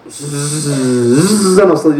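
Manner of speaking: slow buzzing wind sound; wind noise. Shown as a demonstration. Preacher imitating the strange wind for the congregation. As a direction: buzz it out slowly like rushing wind. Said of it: "ఒక గాలి వస్తుంది".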